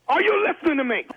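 high, insistent demand; scolding, high-pitched question. Acted. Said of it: "keep raising your voice at like that".

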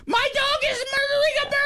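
very high imitated horror; wife aghast at the dog killing a bird; mock horrified wife. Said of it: "She was like".

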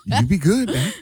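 mocking echo of the co-host's inflection; teasing copy of a send-off; mimicked slave-message inflection. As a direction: imitate a folksy plantation send-off. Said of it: "Your inflection was that of".

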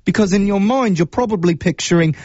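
hammy fake British accent. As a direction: lay on a bad British accent. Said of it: "use my absolute worst British accent".

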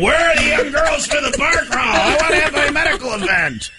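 high-pitched excited holler; mock calling out. Host out there looking for the bar crawl, playful holler. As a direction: holler it out, half laughing. Imagines himself calling out, "going.

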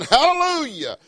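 high, ringing praise cry; ringing hallelujah. Acted. Preacher voicing the crowd in heaven, high ringing hallelujah. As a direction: let it ring out high.

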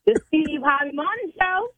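high, lilting show-name call; in her tone. Pretend. Interpreter naming the radio show in her own tone on request. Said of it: "Tell me in your tone".

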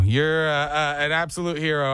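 ordinary talk

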